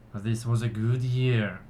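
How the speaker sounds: measured ceremonial address to a gathering; solemn, projected speech of thanks; elf orator. Acted. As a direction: proclaim it to your people with pauses.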